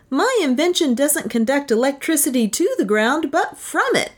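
high-pitched, insistent inventor; retorting inventor. A narrator's reading. Inventor explains his rod to doubting official, retorting. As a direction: correct them in a high, emphatic voice. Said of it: "retorted my red-headed friend".

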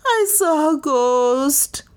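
slow, high, drawn-out wail. A narrator's reading. Maid telling newcomer about the ghost, wailing. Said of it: "Lizzie wailed".

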